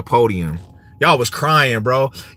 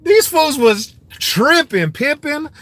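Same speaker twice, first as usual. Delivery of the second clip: gloating laugh; 11 semitones higher, slower than usual. Real, not acted. Gloating laugh at Bills fans.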